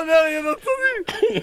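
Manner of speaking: slow, high, laughing till crying. Real, not acted. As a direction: drag the words out through tears of laughter. Laughing at inaudible clip.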